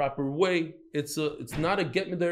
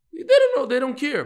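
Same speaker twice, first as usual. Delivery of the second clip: "They don't know" thrown out much higher and louder, fed up; exasperated outburst; 10 semitones higher, 7 dB louder than usual. Real, not acted. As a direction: blurt it out in disbelief. Exasperated about ignorant rabbis.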